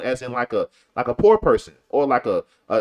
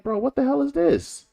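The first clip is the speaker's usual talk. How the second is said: high-pitched, incredulous objection; put-upon passerby, voice pitched high; exasperated bystander; 9 semitones higher than usual. Retold or imitated. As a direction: protest in disbelief, pitch raised well up. Imagined bystander objecting to protesters.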